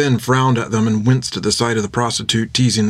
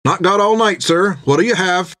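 higher, eager barkeep pitch; tavern keeper voice; 7 semitones higher than usual. A narrator's reading. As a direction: sell your beers like a chatty barkeep.

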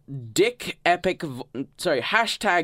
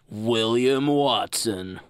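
awesome voice; 2 semitones lower, slower than usual. Pretend. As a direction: intone the name like a grand announcer. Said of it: "in my awesome voice".

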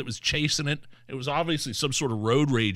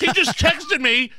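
excited; 6 dB louder, slower than usual. Real, not acted. Excited retelling.

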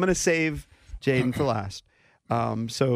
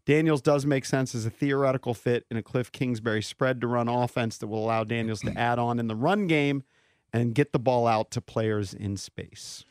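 ordinary talk, nothing marked

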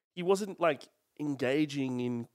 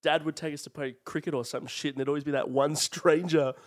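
normal talking voice

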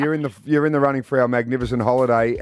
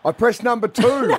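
higher, exasperated caller pleading; frustrated caller; 9 semitones higher than usual. Pretend. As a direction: whine at the phone menu. Caller stuck in phone menu.

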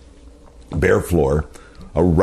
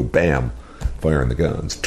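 ordinary delivery

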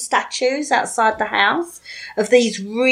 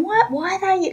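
baffled question; 10 semitones higher, faster than usual. Retold or imitated. Baffled question at dogging site.

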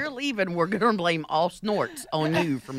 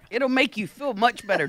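usual speaking voice

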